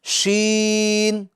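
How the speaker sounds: شین held long with the mid tongue on the palate; drawn-out sheen. Shown as a demonstration. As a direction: stretch شین slowly and clearly. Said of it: "ادا ہو جائے گا آسانی سے". (شین کو بیچ زبان سے لمبا پڑھیں)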